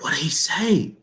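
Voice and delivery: soft, curious listener voice; hanging audience. Acted. Coach voices an audience waiting on the story, soft curiosity. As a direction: ask it softly, hungry for the answer. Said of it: "'cause they're like".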